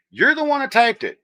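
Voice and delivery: high-pitched blame thrown back; worked-up, high comeback; indignant accusation. Real, not acted. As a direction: protest high, with a falling end.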